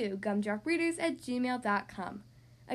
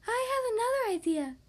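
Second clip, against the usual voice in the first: higher, perky spider; spider lady voice; 9 semitones higher than usual. Acted. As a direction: chirp the idea brightly. Spider offers help.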